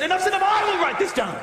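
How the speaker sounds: high, loud, fast fed-up outburst; a fed-up ancestor starting the records; fed-up declaration. Retold or imitated. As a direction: declare it with exasperation. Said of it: "somebody said".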